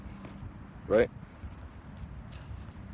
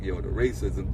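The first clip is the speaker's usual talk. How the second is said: low hushed alarm; 11 semitones lower, 3 dB quieter than usual. Retold or imitated. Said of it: "We be like".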